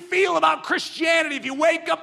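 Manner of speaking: high, loud, shouted list; rally the room with names; rousing preaching. Real, not acted. Preacher naming heroes of faith to rouse the church, shouting high.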